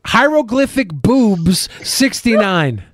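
slow, gleeful nonsense recital; slow mock recital. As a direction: recite it slowly like a joke. Riffing on P O box.

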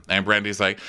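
ordinary talk

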